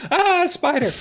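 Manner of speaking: high mock Minnesota accent yelp; a hammed-up Minnesota girl shrieking at a spider. Mindy spotting a spider, Minnesota accent. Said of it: "doing Minnesota accents".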